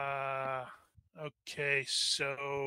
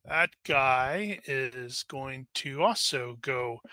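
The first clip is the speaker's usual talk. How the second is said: ordinary delivery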